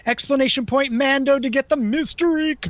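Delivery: loud announcement. Real, not acted. Announcing the giveaway word.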